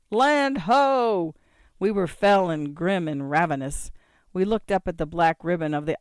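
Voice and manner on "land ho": high, loud lookout's call; ringing, drawn-out holler; cried out. A narrator's reading. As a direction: sing out a sighting across the ship. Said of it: "Thorkel Ale Lover cried".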